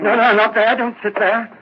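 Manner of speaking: high, loud frantic cry; panicked outburst. Acted. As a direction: blurt it out in sudden panic. Panicked, stops constable sitting over body.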